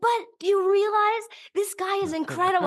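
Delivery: high, re-enacted gushing protest; amazed insistence. Moviegoer arguing with the shushing audience, high excited insistence. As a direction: insist high, overcome with admiration.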